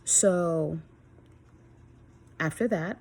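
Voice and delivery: usual speaking voice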